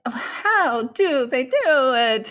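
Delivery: Italian accent. Real, not acted. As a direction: stretch it out like an Italian. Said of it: "saying that in Italian accent".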